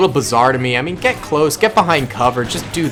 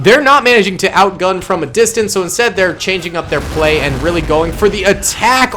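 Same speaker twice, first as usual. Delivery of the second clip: ordinary talk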